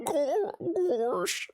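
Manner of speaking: high, badly faked cartoon voice; botched attempt at a cartoon yelp; Goofy. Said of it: "I'm bad at Goofy".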